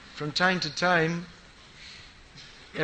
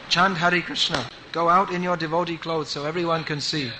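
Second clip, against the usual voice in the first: ordinary delivery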